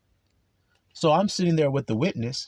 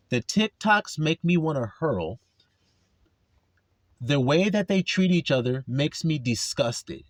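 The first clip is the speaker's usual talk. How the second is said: normal talking voice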